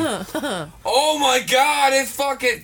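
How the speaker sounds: high, strained, drawn-out cry; mock pained yelp. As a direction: ham up a burning mouth. Imitating burning mouth.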